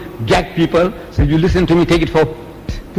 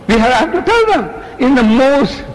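sweet diplomatic; 7 semitones higher than usual. Real, not acted. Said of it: "most sweet, diplomatic manner".

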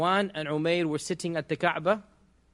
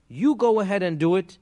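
louder, higher retold go-ahead; emphatic urging; 4 semitones higher, 8 dB louder than usual. Safwan egging Umayr on to act, emphatic urging. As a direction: urge him on, voice raised.

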